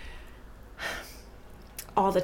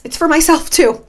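emphatic; 8 semitones higher, 8 dB louder than usual. Real, not acted. Insisting podcast is hers too.

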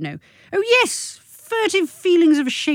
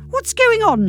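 put-on higher, demanding teen voice; teenage daughter; 13 semitones higher, 4 dB louder than usual. Teen daughter demanding from the kitchen doorway. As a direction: snap it like a put-out sixteen-year-old. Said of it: "pretty good impression of a teenager".

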